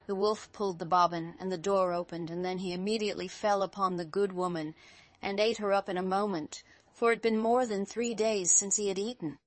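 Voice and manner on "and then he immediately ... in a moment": ordinary delivery, nothing marked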